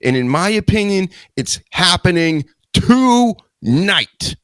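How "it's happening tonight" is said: dramatic hype. Acted. Dramatic announcement, drawn out.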